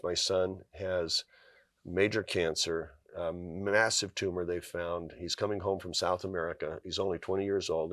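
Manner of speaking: quietly sharing terrible news about his son; grave news. Retold or imitated. Telling the group his son has cancer.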